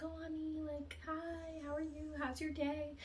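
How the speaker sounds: high, sweet motherly greeting; mom's sweet voice. Retold or imitated. Dreamer imagines her mom greeting her, high and sweet. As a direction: greet your child like a doting mom.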